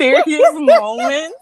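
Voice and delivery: laughing through the words slowly. Real, not acted. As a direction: giggle while saying it. Laughing mid-sentence.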